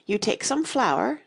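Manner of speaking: keen pupil's measured answer; eager. A narrator's reading. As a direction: begin the answer eagerly, drawing it out. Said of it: "Alice cried eagerly".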